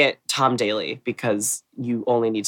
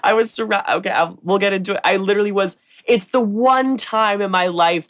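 laughing; 8 semitones higher than usual. Real, not acted. Laughing while confessing a blunder.

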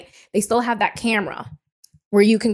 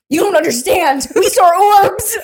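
mom insisting excitedly; 10 semitones higher, 8 dB louder than usual. Retold or imitated. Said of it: "My mom goes".

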